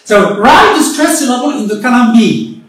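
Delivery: usual speaking voice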